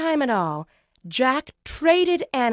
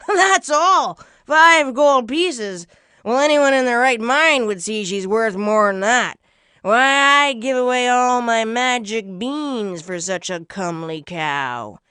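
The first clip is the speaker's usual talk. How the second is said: put-on loud, folksy old trader; big old-timer voice, making an offer; strange old man; 8 dB louder than usual. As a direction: exclaim it like an astonished old huckster. Old stranger haggles for cow.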